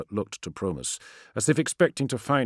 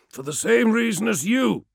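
sharp, higher, louder retort; snapping; 6 dB louder than usual. Acted. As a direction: snap back in irritation. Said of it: "snapped Menkora".

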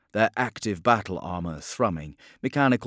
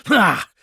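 scornful bark of laughter; 12 semitones higher, 10 dB louder than usual. Acted. Contemptuous outburst.

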